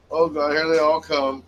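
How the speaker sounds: ordinary delivery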